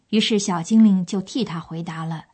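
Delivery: ordinary talk, nothing marked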